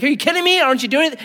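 mock exasperated prophet; incredulous complaint. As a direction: protest in disbelief to God.

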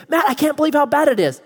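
high, alarmed voice of a worried listener, a touch mock; worried churchgoer. Someone panicking over the news, to Matt, mock-alarmed. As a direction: play the fretting news-watcher complaining. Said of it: "they'll say".

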